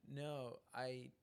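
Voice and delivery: ordinary talk, nothing marked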